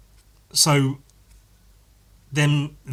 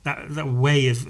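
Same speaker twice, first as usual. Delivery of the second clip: ordinary delivery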